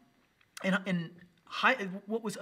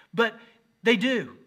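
sudden loud punch; 7 semitones higher, 10 dB louder, slower than usual. Real, not acted. Teens rolling eyes, punchline.

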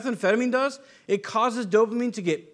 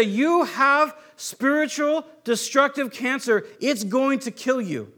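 slow, raised voice delivering a diagnosis; slow, emphatic warning in a higher pitch; solemn pronouncement; 6 semitones higher, slower than usual. Acted. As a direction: pronounce it like a verdict, word by word. Acted grave diagnosis from the law.

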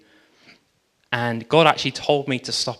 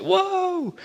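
much higher, startled squeal of wonder; amazed whoa. Retold or imitated. Woman stepping on her healed foot, amazed. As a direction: gasp it out in astonishment. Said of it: "she's like".

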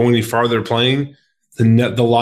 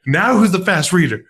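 gloating taunt; 8 semitones higher than usual. Retold or imitated. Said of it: "And I was like".

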